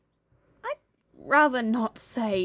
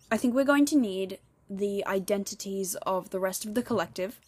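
ordinary talk

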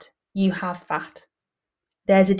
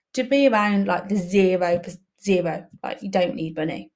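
usual speaking voice

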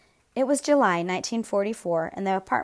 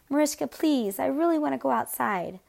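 put-on higher-pitched child's plea; pleading; 6 semitones higher than usual. A narrator's reading. David asking Mariska to take him out, pleading. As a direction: beg her, pitched up throughout.

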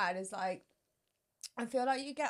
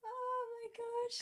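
tearful; 11 semitones higher, 4 dB quieter, slower than usual. Real, not acted. Crying on the finale.

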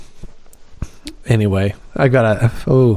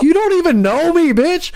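indignant retort. Retold or imitated. Driver snapping back at an insulting drunk passenger, high indignant retort. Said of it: "I'm like".

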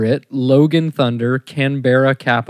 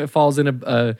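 usual speaking voice

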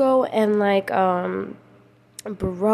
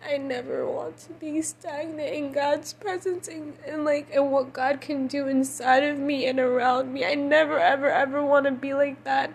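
higher, softened crying voice; tearful earnest pleading; 4 semitones higher, 4 dB quieter than usual. Real, not acted. Speaker asking God to never be stagnant, tearful. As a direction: plead through tears, pitched high.

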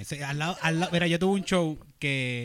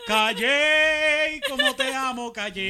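sarcastic mock love; 9 semitones higher, 6 dB louder, much slower than usual. Sarcastic outburst at the town.

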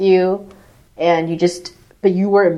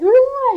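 mock shriek; 3 dB louder than usual.

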